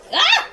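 very high, loud shriek; piercingly high short scream; startled scream. Real, not acted. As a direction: shriek in sudden alarm. Startled during game.

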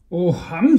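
ordinary delivery

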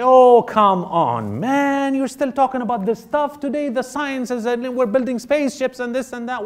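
imitating a scoffer who waves religion away; mocking skeptic. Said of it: "People say".

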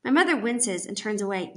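ordinary delivery

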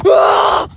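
loud yelp running downhill; shout while running. Real, not acted. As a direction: yell out mid-run. Running down the hill.

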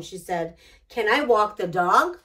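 ordinary delivery, nothing marked

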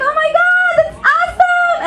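high-pitched, over-the-top squeal of delight; hammed-up excited reaction; gushing excitement. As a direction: gush like a thrilled American friend. Friends' first reaction to the move.

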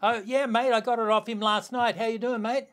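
high, cheery and casual; breezy reply. Retold or imitated. The surfer breezily answering the Hui mob, high and cheery. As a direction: brush it off cheerfully.

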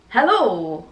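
"Hello" stretched out with a rise and fall; habitual catchphrase hello. Shown as a demonstration. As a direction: swoop up and down on a drawn-out "Hello". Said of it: "every time I say hello, I need to go".

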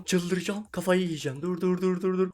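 usual speaking voice

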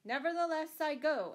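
ringing, insistent declaration; determined. A narrator's reading. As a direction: firmly overrule the doubter. Robin overrules his friend's doubt.

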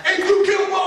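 light up as you name him; excited recognition; delighted recognition. Retold or imitated.

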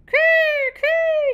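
high, loud gull shriek "Kerr, kerr". Acted. Seagulls shriek and fly off, high loud calls. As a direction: shriek like frightened gulls. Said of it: "shrieked the seagulls".

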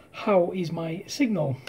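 ordinary talk, nothing marked

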